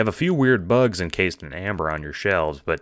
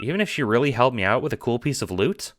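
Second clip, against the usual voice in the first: put-on much higher, lighter boyish voice; John voice; 7 semitones higher than usual. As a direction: lift into a high friendly kid voice. John voiced over chat.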